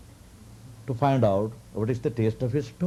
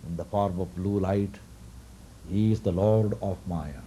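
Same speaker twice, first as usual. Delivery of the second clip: lower, solemn divine voice; revealing god; 4 semitones lower, 3 dB quieter than usual. Retold or imitated. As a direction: Mahavishnu reveals himself to Markandeya, low and solemn. Vishnu reveals himself at length.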